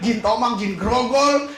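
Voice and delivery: normal talking voice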